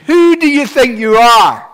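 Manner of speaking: loud, high, indignant shout; imitated crowd outrage. Preacher acting the crowd challenging Jesus, outraged shout. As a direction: yell it like an outraged mob.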